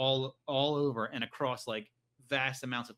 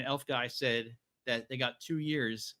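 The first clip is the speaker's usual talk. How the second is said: ordinary talk, nothing marked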